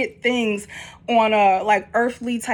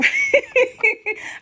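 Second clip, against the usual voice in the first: excited high laugh. Real, not acted. Excited at drawn card.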